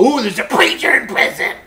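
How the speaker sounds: loud, high-pitched singsong taunt; mocking jeer. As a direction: imitate a crowd making fun. Mocking cellmates he feared would judge.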